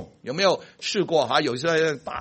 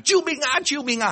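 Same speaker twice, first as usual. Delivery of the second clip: much higher cry of help me; the falling eaglet's cry; 11 semitones higher than usual. Acted. The eaglet falling from the sky, high crying for help. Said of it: "大喊".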